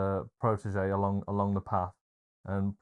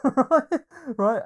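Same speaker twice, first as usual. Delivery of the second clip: laughing; 7 dB louder than usual. Real, not acted. Laughing at the retold film line.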